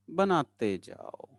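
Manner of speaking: slow refrain, trailing downward; drawn-out coaxing. Real, not acted. Teacher coaxing students to keep drawing, slow drawn-out refrain. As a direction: stretch the words and let them sink. Teacher chanting while class draws.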